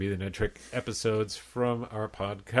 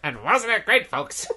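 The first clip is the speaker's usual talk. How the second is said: lightly mimicked comic voice, higher pitch; a higher, put-on take on a TV comedian; Paul Lynde; 9 semitones higher than usual. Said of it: "kind of turned into Paul Lynde".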